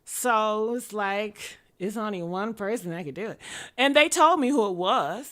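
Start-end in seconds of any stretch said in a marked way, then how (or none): none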